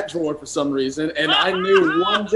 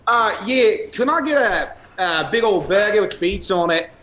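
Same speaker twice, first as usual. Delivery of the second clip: higher-pitched mock Australian; attempted Australian twang; Australian accent; 4 semitones higher, slower than usual. Shown as a demonstration. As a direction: order in a put-on Aussie accent. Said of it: "your turn to do your Australian".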